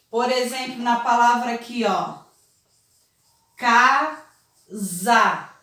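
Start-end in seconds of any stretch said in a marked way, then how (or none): 3.6-5.5 Casa with the S voiced as a buzzing Z, kaza; teacher showing S between vowels. Shown as a demonstration. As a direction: say Casa so the middle S sounds like Z. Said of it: "não tenho mais o som de sa. Ele tem o som de za".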